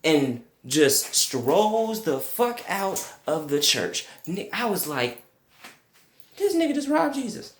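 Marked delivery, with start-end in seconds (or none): 6.4-7.5 high, fast mock-outraged exclamation; shocked. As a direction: blurt it out in high disbelief. Shock at thief leaving church.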